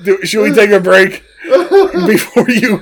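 laughing. Real, not acted. Laughing fit.

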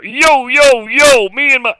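high, loud, put-on street slang swagger; hip hipster voice. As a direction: bust out like a hip-hop guy greeting the crew. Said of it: "I started talking like I'm all hip".

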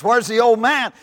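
loud, high-pitched call over a crowd; shouted question to the crowd; loud demand. Acted. Daddy demands to know in crowd.

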